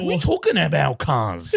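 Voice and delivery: put-on Sydney wog accent with a laugh; slow, laughing wog-accent line. Mocking how Sydney wogs talk, laughing Sydney wog accent. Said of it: "it's like that".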